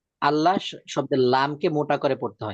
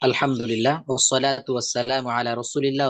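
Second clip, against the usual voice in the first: calm, lower recited invocation; Arabic opening recitation; 4 semitones lower than usual. Acted. Opening recitation before lesson. (শান্ত আরবি তিলাওয়াত)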